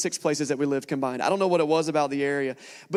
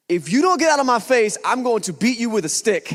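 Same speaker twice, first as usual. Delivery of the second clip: much louder, higher furious shout; imitating the neighbour shouting a threat through the door; exploding threat; 5 semitones higher, 7 dB louder than usual. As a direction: blow up at him with a drunken threat. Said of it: "this lady explodes on me".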